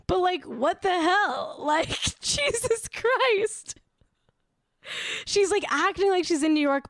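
drawn-out, exasperated groan of disbelief. Real, not acted. To viewers about her beach posts, exasperated and drawn out. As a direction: stretch it out, utterly fed up.

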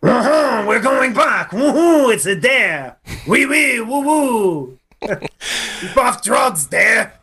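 hammed-up French accent, high and bright; mock French accent. Cohost asked for an accent, so a mock French accent. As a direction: play a cartoon Frenchman, high and excited. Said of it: "I'm just waiting for that accent tone".

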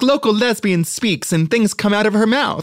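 high, loud mock headline announcer; news anchor voice. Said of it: "they're like".

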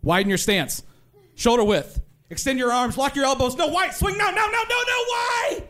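rising into a frantic high yell; high-pitched exasperated coaching that cracks into a shout; mom coaching from the stands. Retold or imitated. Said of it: "she would get very specific".

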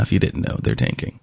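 low, hushed stage whisper; whispered aside. Real, not acted. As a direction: drop to a conspiratorial whisper. Joke aside about the Jets.

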